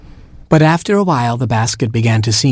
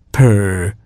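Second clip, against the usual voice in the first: purring cat. Acted. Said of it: "said the cat".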